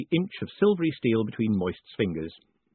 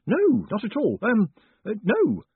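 high, louder, stammering no; flustered denial; 12 semitones higher, 5 dB louder than usual. Acted. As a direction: deny it in a fluster, pitch jumping up. Flustered denial to the lady's question.

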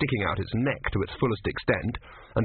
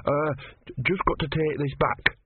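burbling; 5 semitones higher than usual. Acted. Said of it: "Brother burbled".

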